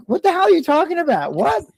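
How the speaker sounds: high-pitched imitation of a wife blowing up; mock outraged wife. Wife hears husband wants another drink, high mock outrage. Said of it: "his wife's yelling at him and screaming".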